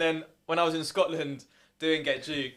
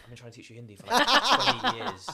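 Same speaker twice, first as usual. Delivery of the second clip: annoyed mum; 5 semitones higher, 5 dB louder than usual. Retold or imitated. Mum complaining about failed Hindi lessons, exasperated. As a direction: imitate mum, stretching the end high and loud. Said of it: "She was like".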